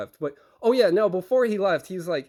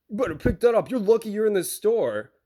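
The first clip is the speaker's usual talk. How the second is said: higher, heated mock threat; imitated escalating threat; 4 semitones higher than usual. Driver in the receiver's face, imitated escalating threat. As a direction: imitate a driver squaring up to someone. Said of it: "it escalates and escalates".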